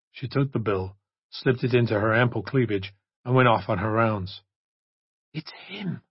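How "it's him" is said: hushed urgent whisper. Acted. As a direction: breathe it so nobody hears. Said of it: "Jennifer whispered".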